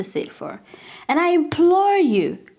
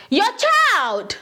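disowning dad; 6 semitones higher, much slower than usual. Retold or imitated. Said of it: "your dad says to your mom".

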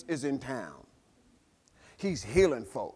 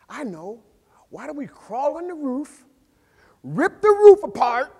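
excited idea; 9 semitones higher, 12 dB louder than usual. Retold or imitated. Friend voices the roof idea.

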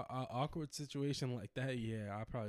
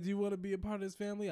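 faster, higher, insistent repeat of the question; pushy coworker repeat; 9 semitones higher, 5 dB louder, faster than usual. Retold or imitated. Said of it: "She said".

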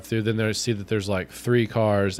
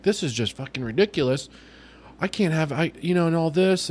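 imitated venting, rising higher; mock exasperated rant; 6 semitones higher than usual. Drive-through woman venting with window open, mock exasperation. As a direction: act out her fed-up grumbling, pitch climbing.

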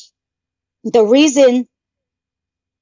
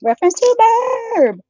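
mock announcement; 12 semitones higher, slower than usual. Jokey aside.

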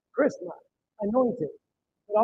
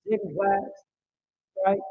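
ordinary talk, nothing marked

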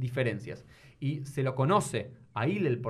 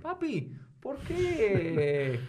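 put-on childish whine; 7 semitones higher, much slower than usual. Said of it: "¿Entendés?".

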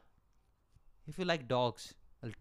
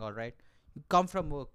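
ordinary delivery

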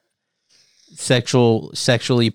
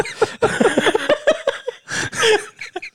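high, soft fit of laughter; laughing; 3 dB quieter than usual. Real, not acted. Laughing after the whispered punchline, real laughter. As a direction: crack up at your own joke.

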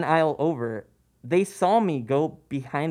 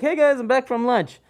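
high, bright call of hey guys; a loud, high cheerful hello; cheery greeting; 11 semitones higher, 6 dB louder than usual. Retold or imitated. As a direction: breezily announce you're back. Cheerful return to coworkers.

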